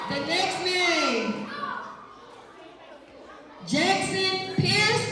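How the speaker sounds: slow, high drawn-out name call; calling the next raffle winner, drawn out; announcer call. Acted. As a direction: build suspense, stretch every word.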